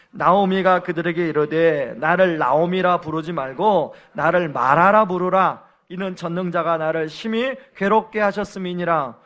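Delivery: loud, solemn reading of scripture. Acted. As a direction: declaim the verse to the congregation. Reads scripture aloud. (성경 봉독 낭독)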